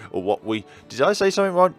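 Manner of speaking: usual speaking voice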